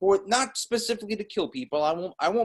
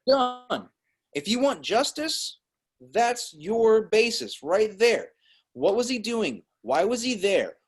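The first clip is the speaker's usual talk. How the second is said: heated, emphatic, higher-pitched insistence; really angry; 5 semitones higher than usual. Real, not acted. Co-host closing his case against the shooter, really angry. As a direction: lay it down point by point, worked up. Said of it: "he got really angry there in the end".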